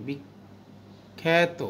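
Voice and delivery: "বিখ্যাত" টেনে টেনে বলো, আকারের উচ্চারণ এ-এর মতো. Shown as a demonstration. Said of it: "এর মতো করছি". (ছাত্রদের শোনাতে "বিখ্যাত" শব্দে সংবৃত আ, খুব ধীরে)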